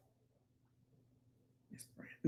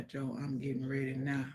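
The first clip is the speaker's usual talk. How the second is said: ordinary delivery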